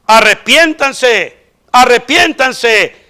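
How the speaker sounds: slow and weighty "arrepiéntanse"; preacher urging repentance slowly; solemn command. Acted. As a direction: draw out each "arrepiéntanse" like a grave command. Apostles' answer to the crowd.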